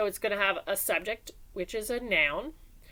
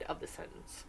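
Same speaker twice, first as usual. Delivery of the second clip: whisper; 4 semitones lower, 15 dB quieter than usual. Shown as a demonstration. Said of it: "trails into whisper".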